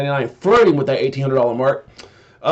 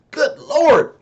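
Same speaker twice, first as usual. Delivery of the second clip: surprised; 11 semitones higher than usual. Real, not acted. Amazed at cheap Solana buyers.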